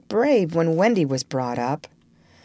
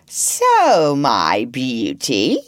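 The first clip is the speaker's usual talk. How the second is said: syrupy; 3 semitones lower, 5 dB louder, much slower than usual. A narrator's reading. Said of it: "as if he spoke in syrup".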